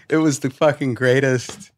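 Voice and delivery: ordinary talk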